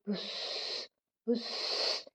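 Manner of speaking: imitated heavy hiss of breath; demon's hissing breath. A narrator's reading. Demon breathing out before the merchant, hissing puffs. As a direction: puff out a long voiceless "puss". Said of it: "அவன் மூச்சு விடும்போது ... என்று ஓசை வந்தது".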